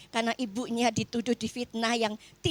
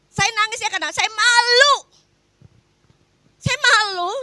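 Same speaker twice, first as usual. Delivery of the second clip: anguished outcry; 9 semitones higher, 8 dB louder than usual. Real, not acted. Tearful testimony of public shame.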